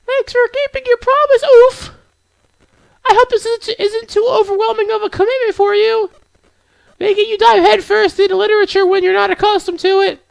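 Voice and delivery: put-on very high-pitched girl character voice; high falsetto anime girl; gentle game girl. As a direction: speak far above your own pitch, welcoming a newcomer.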